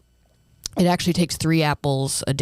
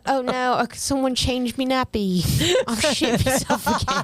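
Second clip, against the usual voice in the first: put-on higher, laughing British toddler whine; whiny baby voice; 9 semitones higher than usual. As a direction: whimper like a soiled baby man.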